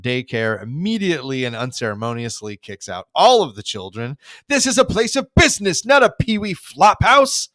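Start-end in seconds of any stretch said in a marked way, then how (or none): 4.5-7.5 high, loud, sing-song sneer; loud, high, lilting old-man scorn; indignant Burns. Retold or imitated. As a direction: throw the kids out with haughty disdain. Burns evicting children from office.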